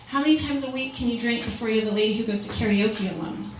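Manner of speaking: usual speaking voice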